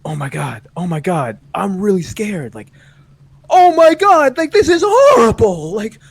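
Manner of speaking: mock fear building from plain to high, loud screaming; exaggerated nervousness. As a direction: start contained, escalate into hammed-up panic. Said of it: "Instead of trying to feel less nervous".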